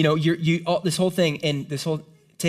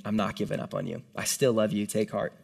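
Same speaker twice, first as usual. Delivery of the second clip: tender hushed reassurance; 7 semitones lower, 6 dB quieter than usual. Retold or imitated. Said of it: "God's saying".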